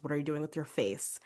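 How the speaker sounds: ordinary talk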